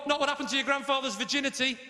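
ordinary delivery

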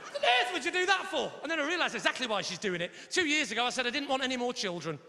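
usual speaking voice

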